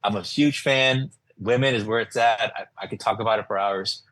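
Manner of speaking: normal talking voice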